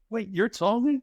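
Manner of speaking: shocked high question; surprised. Retold or imitated. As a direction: mom caught off guard by the letter, high and surprised. Mom surprised at stepson's Tongan.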